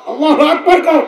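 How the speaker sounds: loud joyful takbir call with a laugh; rally the crowd to shout it; rousing call. Real, not acted. Preacher rouses the crowd to answer, loud and laughing.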